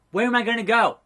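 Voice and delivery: worried question, not yet shouted; contained dismay. Acted. Fired wrestler asks boss, mildly dismayed. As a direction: ask it with mild mock dismay.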